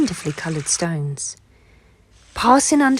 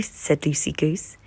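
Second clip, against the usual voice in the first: plain reading voice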